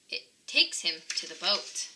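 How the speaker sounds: usual speaking voice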